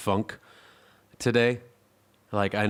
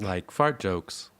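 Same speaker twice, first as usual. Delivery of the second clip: slow cheeky aside; much slower than usual. Pretend.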